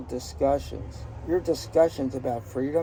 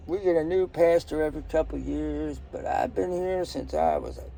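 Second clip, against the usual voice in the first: put-on Southern accent; 2 semitones higher than usual. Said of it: "I'm doing this with a Southern accent".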